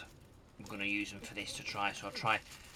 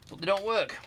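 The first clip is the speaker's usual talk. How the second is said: high, louder comic voice for the punchline; mock squeaky complaint; 10 semitones higher, 5 dB louder than usual. Vlogger joking to viewers that the manual's radios fail, in a high comic voice. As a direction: pipe it up high like a cartoon gripe. Deadpan joke about useless instructions.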